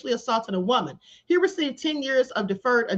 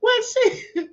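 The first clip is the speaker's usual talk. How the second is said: laughing; 7 semitones higher than usual. Real, not acted. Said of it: "done and crying, laughing".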